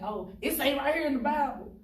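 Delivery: mock the ones who quote scripture at you; mocking self-righteous believer. To the hosts, mocking judgmental churchgoers, hammed-up preachy quote. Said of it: "easy for people to play believer".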